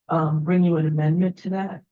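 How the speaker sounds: ordinary delivery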